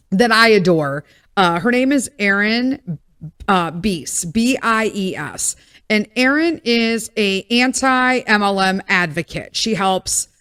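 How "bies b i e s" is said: normal talking voice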